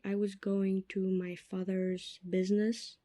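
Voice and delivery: ordinary talk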